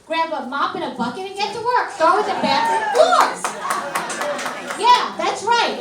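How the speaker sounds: mock drill sergeant. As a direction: order the kids to scrub floors. Barking chores at kids.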